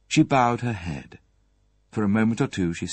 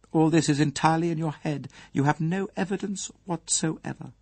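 cold, higher lady's voice, a touch fast; icy cold; 8 semitones higher than usual. Acted. Mrs Folliot dismissing Poirot's accusation, in an icy tone. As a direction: freeze him out with each word. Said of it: "Her voice had the coldness of ice".

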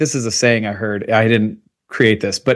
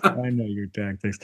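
quiet aside; 5 semitones lower, 10 dB quieter than usual. Pretend. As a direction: repeat it lower and much softer, under your breath. Repeats the jab, drops away.

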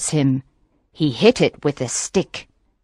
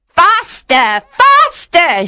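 high, loud urging, second word higher; excited cry; 12 semitones higher, 5 dB louder, slower than usual. A narrator's reading. Witch dancing with the fisherman, crying out. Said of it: "she cried".

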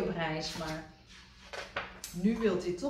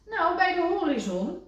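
high, louder little frog; Kikker's voice; 12 semitones higher, 6 dB louder than usual. A narrator's reading. Kikker explaining to Rad, high and loud. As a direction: pipe up innocently.

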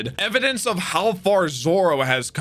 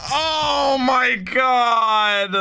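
stunned gasp; 7 semitones higher, much slower than usual. Real, not acted. Stunned by the anime punch.

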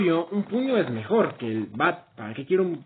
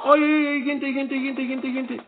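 panicked calling out; 6 semitones higher, slower than usual. Real, not acted. Panicking to viewers mid-fight.

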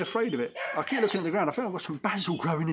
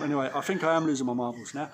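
usual speaking voice

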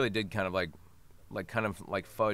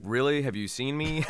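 higher, louder disbelieving retort; incredulous; 6 semitones higher, 5 dB louder than usual. Retold or imitated. Actor answering fans who think he flirts in accent, incredulous. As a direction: retell it as amused disbelief. Incredulous reply to fans' question.

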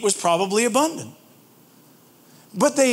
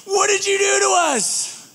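angry shout; 10 semitones higher than usual. Acted. Israelites shouting at Moses in the desert, high angry shout. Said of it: "I understand why they sit here and go".

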